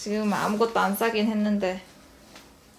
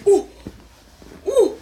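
excited; 8 semitones higher, 7 dB louder, much slower than usual. Real, not acted. Baby climbing up.

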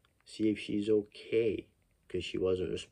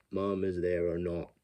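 ordinary talk, nothing marked

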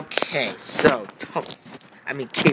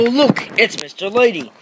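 ordinary delivery

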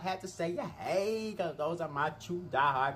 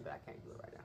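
whisper; 17 dB quieter, much faster than usual. Real, not acted. Drops voice after sing.

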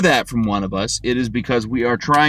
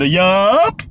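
clipped, louder, higher yup; curt American yup; 5 semitones higher, 4 dB louder than usual. Retold or imitated. An American ending a chat with a European, curt yup. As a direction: shut it down with one word. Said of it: "At the end".

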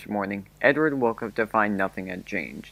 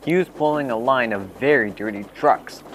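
ordinary delivery

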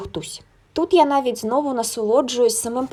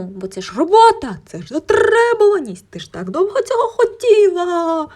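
inner voice; 8 semitones higher, 4 dB louder than usual. Retold or imitated. Inner voice urging to take work.

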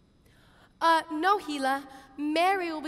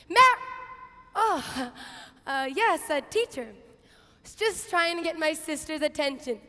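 usual speaking voice

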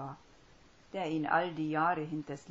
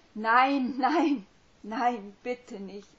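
screamed, higher and louder, fading into begging; panicked pleading; 8 semitones higher, 13 dB louder, much slower than usual. Acted. Man pleading silently with Hermine, panicked scream. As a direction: beg in rising panic. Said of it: "wollte er schreien".

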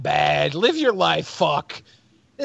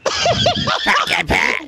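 high shrieking burst of laughter. Real, not acted. Host hearing the replayed clip, high shrieking laugh.

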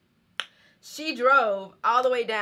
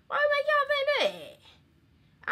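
mock surprise. Real, not acted. Joking denial of an assumption.

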